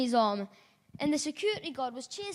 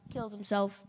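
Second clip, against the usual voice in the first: ordinary delivery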